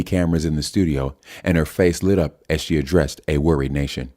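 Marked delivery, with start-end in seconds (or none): none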